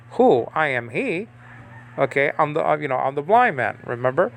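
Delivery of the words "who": usual speaking voice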